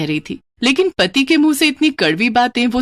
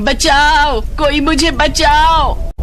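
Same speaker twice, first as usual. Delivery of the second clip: high, loud, drawn-out cry of terror; desperate shriek, dragging out the last word slowly; panicked scream for help; 7 semitones higher, 4 dB louder, much slower than usual. Acted. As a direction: scream for help as the flames catch you. Saree on fire, screaming for help.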